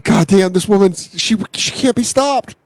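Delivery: rising high in disbelief; hammed-up exasperated awe; mock amazement. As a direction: act floored, pitch climbing to the end. Acted onlooker amazed at the woman.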